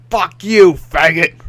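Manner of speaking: loud sneering jeer; mock angry outburst. Mocking a viewer who yells at the streamer. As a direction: yell it like a troll in chat.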